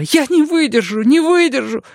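put-on high, frantic young man pleading; desperate youth begging for help; Mikael panicking.

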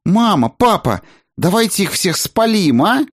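put-on high, eager girl's voice; blurting out. A narrator's reading. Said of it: "выпалила жемчужноволосая Айсонна". (выпалить высоким девичьим голосом)

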